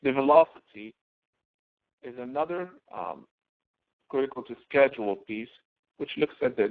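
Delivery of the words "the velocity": normal talking voice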